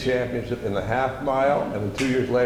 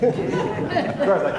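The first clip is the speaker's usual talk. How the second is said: laughing it off; a big laugh through the words; 9 semitones higher, much slower than usual. Real, not acted. Laughs while recalling failure.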